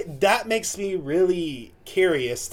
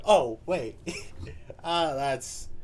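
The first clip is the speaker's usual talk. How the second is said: ordinary talk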